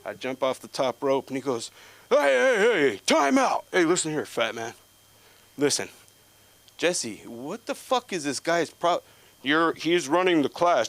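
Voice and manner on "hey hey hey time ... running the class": high, loud mock coach bark; yelling coach. Wrestling coach stopping class to yell at the fat kid, high and loud.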